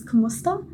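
Kumusta said the Filipino way, KOO-moos-tah with a lift at the end; Filipino greeting. Shown as a demonstration. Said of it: "How do you say hello in".

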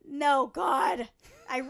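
usual speaking voice

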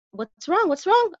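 high-pitched, alarmed questioning; acted high-voiced concern; concerned passerby.

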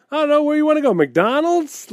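high, loud joking offer; joking Donnie. Retold or imitated. Donnie joking with Carol about dinner, high joking tone. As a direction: play it off as a joke. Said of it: "trying to make a joke out of it".

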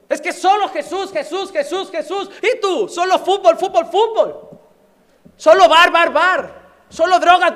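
loud, driving sermon delivery; fiery preaching. Acted. Preacher rousing the church against worldly idols, full-force preaching. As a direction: preach it hard to the congregation.

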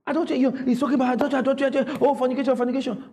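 fast, high mock rant; harping on adultery. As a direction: rattle it off, repeating like a nagging preacher. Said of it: "will harp on adultery".

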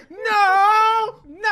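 high, very loud wailing shout; long hammed-up cry of horror; mock anguished scream. Father hears shotgun from another room, hammed-up wail.

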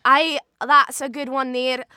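general Scottish accent, rolled R's. Shown as a demonstration. Actor demonstrating for the host, Scottish accent. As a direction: speak in Scottish, rolling the R's. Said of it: "All right, Scottish".

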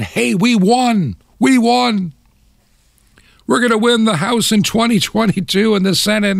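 high-pitched imitation of gleeful voters; mock celebration. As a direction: crow it high, stretching the cheer. Mocking voters celebrating small victories.

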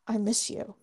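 low, pointed mock come-on; flirt line. Host imitating Colin's flirty confession, low and pointed. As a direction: drop it low like a smooth line. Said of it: "a flirt line with your friend".

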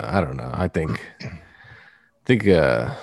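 normal talking voice

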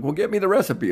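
wife's request. Retold or imitated. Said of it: "she's like".

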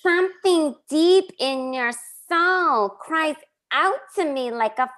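high, very slow dramatic Janice voice; Janice impression. Host performing Janice's foghorn speech, slow and high. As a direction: stretch every word like a soap diva. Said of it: "your Janice impression".

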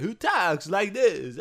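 slow, halting Walken drawl; Christopher Walken impression. Telling his co-host who the guy is, in a slow Walken imitation. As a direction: do a Walken, pausing oddly. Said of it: "who's the guy who's like".

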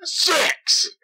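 frantic high British countdown yell; British accent countdown. Retold or imitated. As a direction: scream the countdown like a crazed Brit. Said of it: "not with a British accent".

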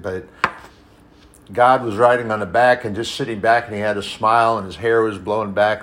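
ordinary talk, nothing marked

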